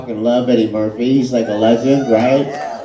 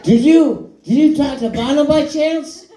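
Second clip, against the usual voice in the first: Jimmy Fallon; 13 semitones higher than usual. Retold or imitated. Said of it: "I'm trying to do a Jimmy Fallon impression".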